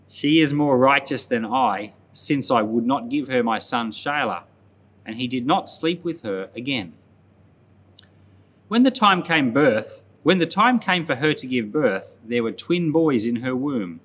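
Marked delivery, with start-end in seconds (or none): none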